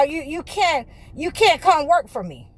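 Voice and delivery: ordinary talk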